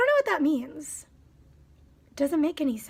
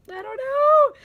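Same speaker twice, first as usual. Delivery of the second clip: high, loud "I don't know"; exasperated; 10 semitones higher, 5 dB louder, slower than usual. Real, not acted. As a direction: throw up your hands vocally.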